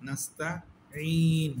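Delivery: নাস্তাঈন with ঈ stretched, stopping on a silent ন; pausal form. Shown as a demonstration. Teacher showing how to stop at the verse end. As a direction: hold the ঈ in নাস্তাঈন, then stop. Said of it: "তখন এটাতে কি আসবে?".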